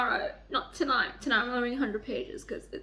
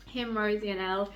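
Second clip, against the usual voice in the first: ordinary delivery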